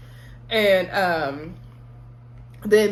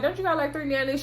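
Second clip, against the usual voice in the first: higher-pitched, skeptical mock question; incredulous question; 5 semitones higher, faster than usual. Candace doubting the single mom, high and incredulous. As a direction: call her bluff in disbelief. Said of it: "And Candace was like".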